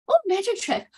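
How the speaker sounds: lightly hammed-up magician's surprise; mock amazement. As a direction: play baffled at a vanishing act. Acting out the disappearing eyeshadow.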